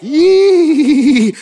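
high, loud whoop of joy; preacher's whoop. Real, not acted. Preacher to his congregation, whooping high and loud. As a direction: let out a joyful holler.

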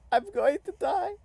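ordinary talk

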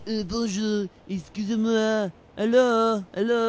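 put-on French accent. French visitor calling out to the tour guide, French accent. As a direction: call out hello the French way.

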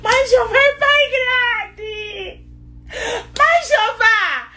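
joyful sobbing exclamation, pitched high; crying out in joy. Real, not acted. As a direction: cry out to God, overwhelmed with joy. Overwhelmed by good news, crying out.

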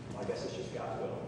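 low, quiet, quick mock condolence; imitating a feeble consoler; resigned platitude. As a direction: murmur a stock funeral line. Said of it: "the one that everybody drops".

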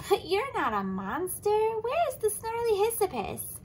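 high, baffled question; puzzled. Acted. Elephant puzzled to find no monster. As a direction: ask it bewildered.

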